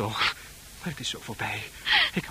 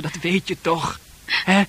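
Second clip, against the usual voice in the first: ordinary talk, nothing marked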